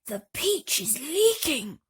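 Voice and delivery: loud alarmed shout, slow. A narrator's reading. Grasshopper shouting to everyone that the peach leaks. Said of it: "shouted the old green grasshopper".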